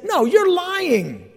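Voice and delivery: a hint of an angry servant; mock accusation. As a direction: get in his face and call him a liar. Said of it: "Someone got in his face and said".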